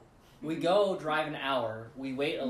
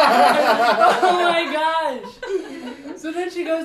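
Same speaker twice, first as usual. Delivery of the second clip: laughing; 12 semitones higher, 14 dB louder, slower than usual. Retold or imitated.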